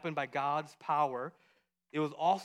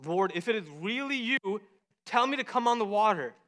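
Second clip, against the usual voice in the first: high, pleading call; raised, earnest acted plea; Peter calling out; 5 semitones higher, 3 dB louder than usual. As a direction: call out across the water. Voicing Peter calling over the storm.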